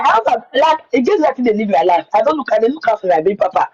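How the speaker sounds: ordinary delivery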